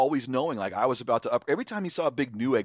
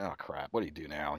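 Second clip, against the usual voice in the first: dreading muttered aside; 7 semitones lower, 10 dB quieter than usual. Retold or imitated. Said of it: "I'm like".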